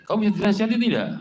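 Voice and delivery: high, loud and fast scolding; a husband snapping at his stubborn wife. Acted. Husband raising his voice at wife over prayer. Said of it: "dia angkat suaranya".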